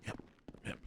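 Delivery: whispered resigned curse. Real, not acted. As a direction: breathe it out in a whisper.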